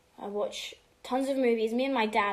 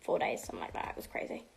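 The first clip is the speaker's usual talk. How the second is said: whispered; 4 semitones lower, 6 dB quieter, faster than usual. Real, not acted. Whispered aside.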